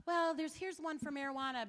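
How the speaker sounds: high, bright-eager mock petitioner; imitating a chirpy young canvasser; mock eager young man. Speaker mimicking the young petitioner, high and eager.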